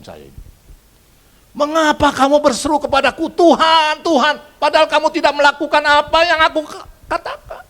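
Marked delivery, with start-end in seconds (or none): 1.5-7.6 high, loud preached scripture; fiery sermon delivery; preaching at full force. Acted. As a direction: proclaim it to the whole hall.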